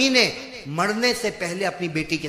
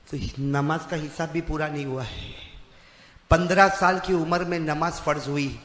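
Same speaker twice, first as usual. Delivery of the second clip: ordinary talk, nothing marked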